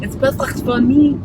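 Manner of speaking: hammed-up French-accented English; attempted French accent. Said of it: "speaking English in a French accent".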